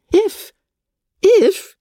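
high, loud incredulous echo; shocked, indignant repetition; outraged disbelief. Acted. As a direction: fling the word back at her, scandalised. Lady Catherine outraged at a word.

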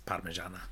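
"Parmigiana" said softly with an Italian lilt; Italian pronunciation. Shown as a demonstration. Mocking how people say Parmigiana, softly in Italian style.

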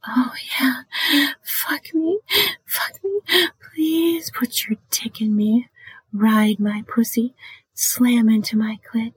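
high breathy whisper, then hushed; high whispered bedroom begging; sexy whisper. Shown as a demonstration. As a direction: whisper the pleas into an ear. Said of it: "whispering can be effective".

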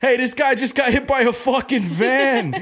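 high-pitched, amused Boston holler; Boston bouncer. Retold or imitated. Bouncer announcing the accident to the bar, Boston accent. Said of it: "it's so Boston. So Boston".

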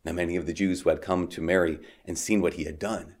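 measured, solemn Gospel reading; scripture reading. Acted. Reads the Gospel aloud.

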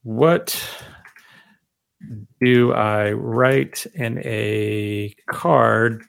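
words stretched out slowly as if typing; very slow spoken typing; slow dictation. Shown as a demonstration. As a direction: dictate it word by word to the keyboard. Typing prompt aloud while dictating.

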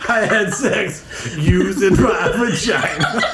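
high words through helpless laughter; laughing. Real, not acted. Host still laughing at the vagina line. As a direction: giggle through every word.

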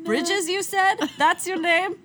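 high, loud eager boss voice; a mock minister thrilled to hire; excited boss. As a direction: hire him on the spot, delighted. Mock minister hiring Bridges.

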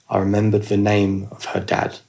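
ordinary talk

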